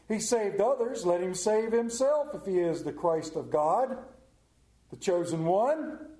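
mocking high sneer, loud; sneering rulers. Preacher voicing rulers mocking Jesus, sneering. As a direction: jeer it like a ruler at the cross.

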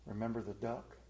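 quiet blackmailing whisper. Retold or imitated. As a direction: lean in and breathe the threat. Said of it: "whispered to him".

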